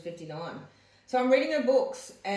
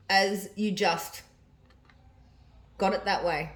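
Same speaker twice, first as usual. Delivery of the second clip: diary reading; 4 dB louder than usual. Acted. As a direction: read the diary entry out with feeling. Reads mum's diary aloud.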